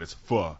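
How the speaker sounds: "pho" said "fuh", with a short open vowel; correct pronunciation. Shown as a demonstration. Diner loves saying that word, "pho" pronounced "fuh". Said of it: "I love saying that word".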